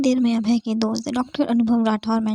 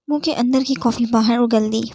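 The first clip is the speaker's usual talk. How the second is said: ordinary delivery, nothing marked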